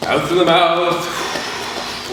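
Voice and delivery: drawn out very slowly; slow exhale cue. Shown as a demonstration. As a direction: stretch it out like a long breath. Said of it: "breathing in through your nose".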